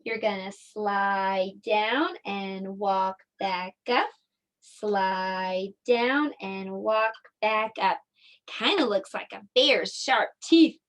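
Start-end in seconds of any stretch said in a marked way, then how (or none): 0.5-8.0 drawn-out words, slow and even; slow stroke-by-stroke. Shown as a demonstration. Teacher guiding children to write a W, slow tracing voice. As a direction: stretch each word along the pencil stroke.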